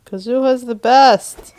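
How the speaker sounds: loud, drawn-out fan cheer; excited cheer. Real, not acted. Player cheering a favourite game character, excited cheer.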